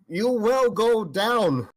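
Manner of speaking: slow, drawn-out vow; determined. Real, not acted. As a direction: stretch each word out with resolve. Player vows slowly at the table.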